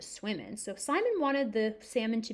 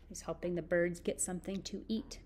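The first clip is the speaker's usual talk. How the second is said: usual speaking voice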